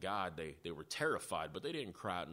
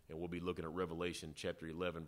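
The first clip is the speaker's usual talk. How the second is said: ordinary talk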